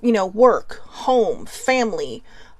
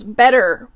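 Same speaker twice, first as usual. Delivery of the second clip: "Better" punched louder, clearly not bitter; stress on better; 8 dB louder than usual. Shown as a demonstration. Correcting her misread note, stressing "Better" loudly. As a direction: hit "Better" hard to fix the misreading. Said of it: "looked like bitter relationships".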